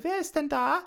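high-pitched questioning call. A narrator's reading. Giant's mother asks who is in the house, calling out high. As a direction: call it out high, wanting an answer. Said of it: "rief eine Stimme".

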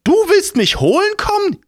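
put-on high, loud old crone voice; shrill old woman. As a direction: squawk it like a cranky old woman.

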